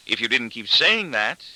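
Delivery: ordinary delivery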